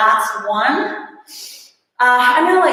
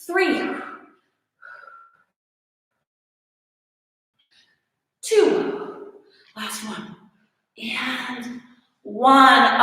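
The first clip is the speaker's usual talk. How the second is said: straining; 7 semitones higher, much slower than usual. Real, not acted. Straining through final reps.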